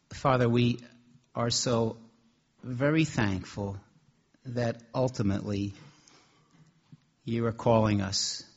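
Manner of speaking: prayer. Real, not acted. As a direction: pray gently with pauses. Preacher leads closing prayer.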